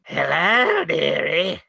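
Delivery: hammed-up witch voice. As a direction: beckon like a storybook witch. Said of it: "Pimple the witch".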